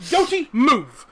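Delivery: high, loud order; loud command. Acted. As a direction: shout at the construct to move. Man orders construct aside.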